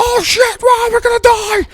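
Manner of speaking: very high panicked mock scream; hammed-up shrieking terror; imitated freak-out. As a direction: mimic a man losing it completely. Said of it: "he started freaking out".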